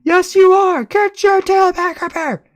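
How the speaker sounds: very high, loud parent voice; parent snapping back. Acted. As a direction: snap back like a furious mother. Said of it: "The voice instantly snaps back".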